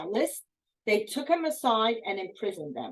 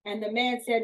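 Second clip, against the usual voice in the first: ordinary talk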